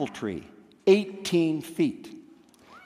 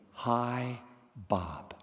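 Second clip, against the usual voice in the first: pained; 5 semitones lower, 11 dB quieter than usual. Retold or imitated. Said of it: "I heard the pain in my dad's voice".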